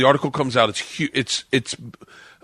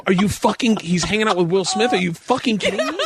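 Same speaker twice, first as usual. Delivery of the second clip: higher-pitched, exasperated mock rant; retold frustration; 6 semitones higher than usual. Imitating comics resenting the newcomer's deal, frustrated disbelief. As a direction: play an incredulous, jealous fellow comic. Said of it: "how frustrating that was to every comic".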